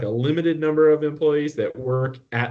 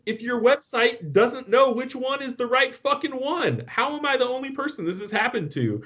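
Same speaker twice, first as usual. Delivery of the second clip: high, incredulous rant; outraged disbelief; 12 semitones higher than usual. Retold or imitated. Customer ranting about the broken website, high and incredulous. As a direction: pitch it way up in exasperated disbelief.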